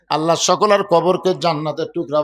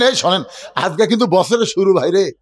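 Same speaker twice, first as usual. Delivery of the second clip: ordinary talk, nothing marked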